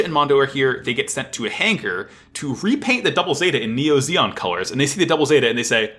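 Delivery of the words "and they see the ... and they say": usual speaking voice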